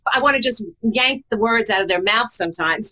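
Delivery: ordinary talk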